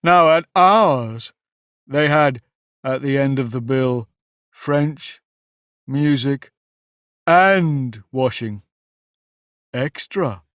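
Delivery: slow, drawn-out mournful turtle. Acted. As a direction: list it slowly and proudly. Mock Turtle lists subjects.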